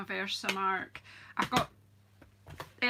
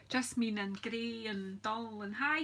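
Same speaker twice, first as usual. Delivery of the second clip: normal talking voice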